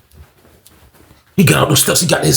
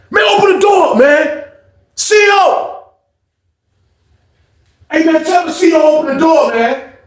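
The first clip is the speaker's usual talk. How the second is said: shouting through door; 11 semitones higher than usual. Retold or imitated. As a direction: yell through a locked door. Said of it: "Chuck was like".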